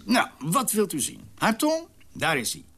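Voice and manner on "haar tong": mocking high question. Mock presenting the tongue.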